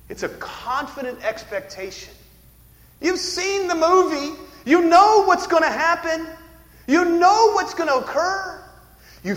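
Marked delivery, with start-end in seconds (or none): 3.0-8.6 high, loud, insistent preacher; full-force preaching. Real, not acted. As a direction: drive each phrase home at full volume.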